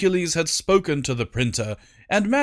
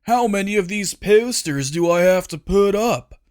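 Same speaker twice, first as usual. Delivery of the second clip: whiny Huncules; 6 semitones higher, 4 dB louder than usual. Acted. Huncules complaining to queen.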